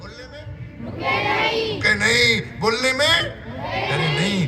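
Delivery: rousing preacher call; fervent exhortation. Acted. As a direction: rouse the children to speak up.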